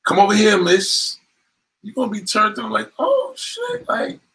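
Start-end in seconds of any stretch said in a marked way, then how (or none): 0.1-1.2 calling out waitress. Retold or imitated. 3.0-4.2 surprised gasp. Retold or imitated. Woman turned on, surprised.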